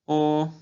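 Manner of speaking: "O" held high and level; first tone. Shown as a demonstration. Teacher practising tones on syllable O, high level "O". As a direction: sustain "O" on one high note. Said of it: "Sílaba O".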